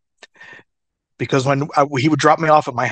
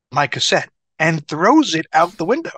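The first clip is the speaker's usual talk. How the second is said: normal talking voice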